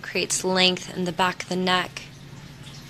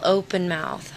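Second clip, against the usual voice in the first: normal talking voice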